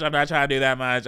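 ordinary talk

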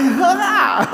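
very high laughing yelp of surprise; a startled laughing gasp; shocked laugh. Retold or imitated. Newcomer hearing the cashier say leave quickly, laughing shock. Reacting to cashier's rude joke.

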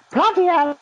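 squealed, startled "bloody hell"; a high, loud burst of surprise; shocked exclamation. Pretend. Imagining the Queen opening box.

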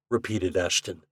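plain reading voice

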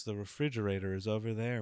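normal talking voice

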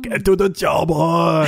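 put-on slow, light Arnold Schwarzenegger accent; Arnie accent. Host asked by co-host for the famous line, light Arnie accent. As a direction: drag it out like Arnie, half-hearted. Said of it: "do you wanna do the Arnie accent".

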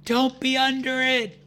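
high, loud cry to allies; yelled warning. Acted. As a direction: yell it out high over the battle. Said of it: "yells out".

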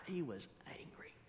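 dropped to a near whisper; hushed emphasis. Real, not acted. Preacher describes an upset pastor, hushed dramatic emphasis.

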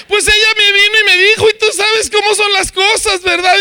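very high, loud whiny excuse-maker; Adán. Acted. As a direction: act out an aggrieved man shifting the blame in a hammed-up high voice. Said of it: "Dios le dice a Adán". (quejoso exagerado, agudo y a la defensiva)

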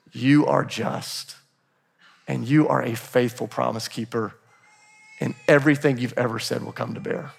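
low, soft, measured recitation of praise; hushed reverence. Retold or imitated. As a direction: speak each line quietly to God, letting it settle. Recites Mary's song to God softly.

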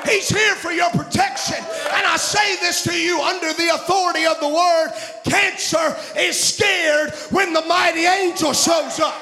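full-force preaching. Acted. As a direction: preach it out to the congregation.

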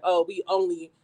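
ordinary talk

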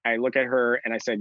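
ordinary talk

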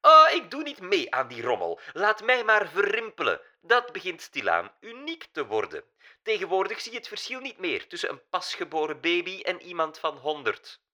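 put-on high, loud German-accented scoff; old German diva dismissing the robots. A narrator's reading. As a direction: brush it off like a vain old German star. Diva's reply.

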